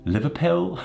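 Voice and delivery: a quick, hammed-up Scouse "Liverpool?"; bad Scouse accent. Shown as a demonstration. Joking about his Liverpool birth, terrible Scouse accent. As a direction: throw in a clumsy Liverpool accent on "Liverpool?". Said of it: "That was a terrible, terrible Liverpool accent".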